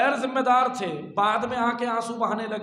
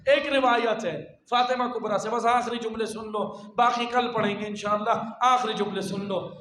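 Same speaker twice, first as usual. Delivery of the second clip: ordinary talk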